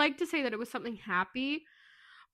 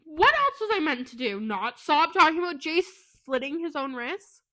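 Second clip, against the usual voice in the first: worked-up, higher and louder protest; indignant rant; 5 semitones higher, 5 dB louder than usual. Real, not acted. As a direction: rant in laughing outrage, pitched up. Mock-upset rant, laughing listeners.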